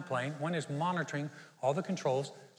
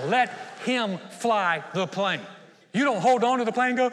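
preaching shout; 6 semitones higher, 8 dB louder than usual. Real, not acted. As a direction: drive the point home to the crowd. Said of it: "preacher shouting the point".

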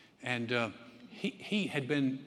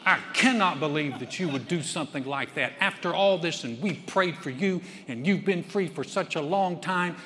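louder, higher mock outrage; mock condemning preacher; 4 semitones higher, 7 dB louder than usual. Judgmental preacher berating a struggling man, loud mock outrage.

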